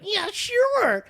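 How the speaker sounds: high-pitched, loud, mock-keen agreement; eager sophomore. Sophomore saying yes to older teammate, mock eager. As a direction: play the over-eager younger kid, squeakily high. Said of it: "I was, like, a sophomore".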